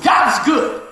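booming testimony in the store; loud proclaiming. Retold or imitated. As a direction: proclaim it so the whole aisle hears. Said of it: "I said".

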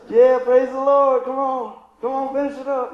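fake-pious nudge to wrap it up; impatient mock praise. Mock churchgoer urging pastor on.

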